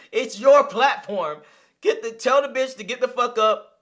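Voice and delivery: cracking up while shouting her off; laughing yell. Real, not acted. Laughing disbelief, then yelling.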